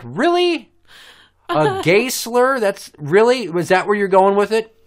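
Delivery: high, loud disbelief; mock incredulity. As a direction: question it as if utterly appalled. Acting out reaction to a slur.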